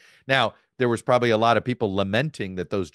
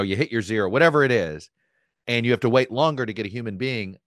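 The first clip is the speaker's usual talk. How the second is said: usual speaking voice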